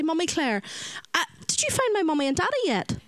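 imitating an eager little girl; child's voice. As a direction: ask hopefully like a little girl. Children asking about parents.

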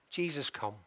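put-on very soft, low echo of a child's prayer; hushed prayer. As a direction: let it drop almost to nothing. Repeats grandson's simple prayer, moved.

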